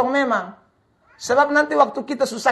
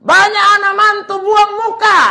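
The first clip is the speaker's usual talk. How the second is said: high loud rebuke; preaching shout; 9 semitones higher, 5 dB louder than usual. Acted. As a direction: shout it at the congregation. Preacher rebukes children-in-law. (teriakan khotbah yang marah)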